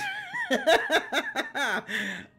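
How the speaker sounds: loud, very high burst of laughter; high cackle. Real, not acted. Streamer being laughed at by guests, high cackle.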